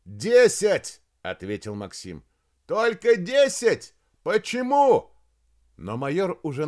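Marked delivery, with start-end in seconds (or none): 0.0-1.0 loud, high call; shouted answer. A narrator's reading. Shouted answer across to colonel. 2.7-5.1 high, loud, drawn-out shout; indignant shout. A narrator's reading. Colonel shouts back in disbelief.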